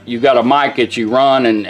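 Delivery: ordinary delivery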